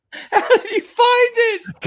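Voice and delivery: laughing. Real, not acted. Laughing at a silly video.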